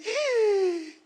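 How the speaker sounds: high-pitched comic shriek of fright; sudden held high scream; startled scream. Retold or imitated. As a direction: shriek like you just saw a Martian. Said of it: "yo grité".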